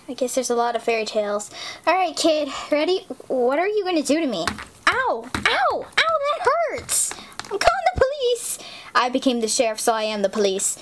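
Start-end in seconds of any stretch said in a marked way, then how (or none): 4.9-8.6 high squealing ow; mock pain. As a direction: squeal and threaten.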